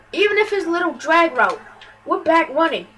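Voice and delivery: usual speaking voice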